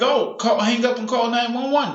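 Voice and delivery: retold onlooker's urgent appeal; urgent bystander. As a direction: call out like a bystander urging help. Urgent onlooker voiced in a story.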